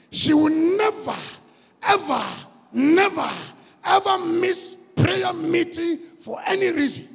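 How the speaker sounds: never and ever each hit hard and slow; stress on never ever. Acted. Prayer leader vouches for the woman, stressing never ever.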